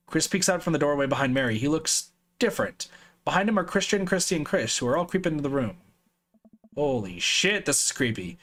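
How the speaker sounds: plain reading voice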